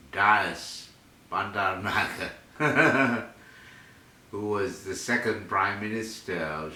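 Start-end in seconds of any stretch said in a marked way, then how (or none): 2.6-3.3 short, high chuckle; a real burst of amused laughter; quick chuckle after reeling off a long name. Real, not acted.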